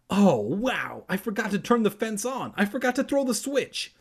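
high, rising exclamation; excited realisation. A narrator's reading. Kermit realises the fence was off, excitedly exclaiming.

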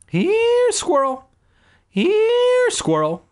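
very high, loud squeaky "Here, squirrel"; sing-song coaxing a frightened squirrel; high calling to a pet. Acted. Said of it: "I called to it".

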